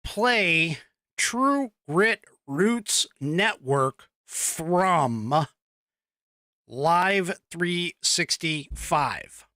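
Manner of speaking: slow, evenly spaced voice command to Alexa; slow spoken command. Shown as a demonstration. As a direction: spell out each word slowly for the device. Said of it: "the key with that one is you have to say".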